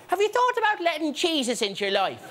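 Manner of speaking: a hint of a cheery doorstep preacher; mock preacher at the door. Door-to-door evangelist asks about Jesus, mildly mocking preacher voice. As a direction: ask brightly like a door-to-door evangelist. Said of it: "Some God botherer knocks on your door".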